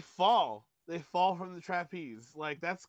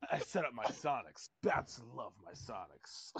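softer, lower hammed-up Batman; corny Batman; 5 semitones lower, 6 dB quieter than usual. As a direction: read it as corny as possible. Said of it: "I just read it as super fucking corny".

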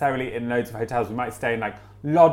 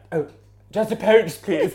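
polite posh guest; 7 semitones higher than usual. Retold or imitated. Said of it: "middle class British people and Americans being like".

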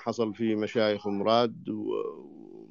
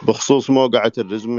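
repeated much louder, right into the mouthpiece; closer and louder; 9 dB louder than usual. Real, not acted. Caller repeating himself after the host complains the voice is distant, louder. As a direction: move up to the phone and say it again loud and clear. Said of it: "قرب السماعة".

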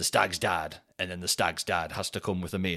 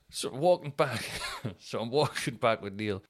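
ordinary talk, nothing marked

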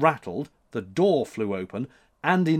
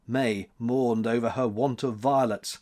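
ordinary delivery, nothing marked

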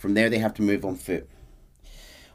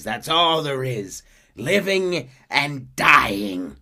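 slow, louder, drawn-out taunt; menacing Micah; 7 semitones higher, 8 dB louder, much slower than usual. Retold or imitated. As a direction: savour each word cruelly.

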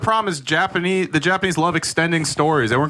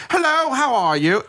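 a hint of mock mid-Atlantic accent, higher; 9 semitones higher than usual. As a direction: lightly imitate an old-school mid-Atlantic greeting. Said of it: "mid-Atlantic accent".

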